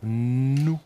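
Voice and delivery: "Nou" with a long humming n; slowly rising, stretched "Nou"; drawn-out syllable. Shown as a demonstration. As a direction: hold the n so "Nou" can be spelt. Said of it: "Comment on va l'écrire?".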